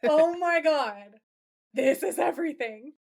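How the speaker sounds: swooning over what comes next; excited gasp. Real, not acted. Gushing over the upcoming scene.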